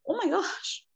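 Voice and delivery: high, soft gasp of dismay; small, high-pitched voice of disbelief; shocked realization. Retold or imitated. Speaker recalls looking at herself, shocked realization.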